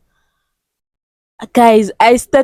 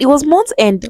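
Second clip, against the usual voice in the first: normal talking voice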